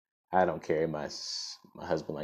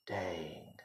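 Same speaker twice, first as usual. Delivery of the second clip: usual speaking voice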